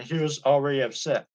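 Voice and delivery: usual speaking voice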